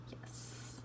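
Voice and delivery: tiny whispered cheer; barely audible, savoring the win; hushed triumph. Real, not acted. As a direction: whisper it to yourself in victory. Private triumph after shop goes live.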